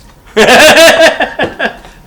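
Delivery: high loud cackling laugh; loud burst of laughter. Real, not acted. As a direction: break up laughing loudly. Big laugh at the hose joke.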